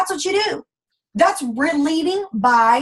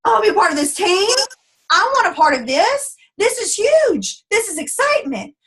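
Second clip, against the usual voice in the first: higher, excited newcomer voice; a new recruit gushing over the team's welcome; thrilled newbie; 7 semitones higher than usual. Retold or imitated. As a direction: gush like you just found something huge. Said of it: "And she's like".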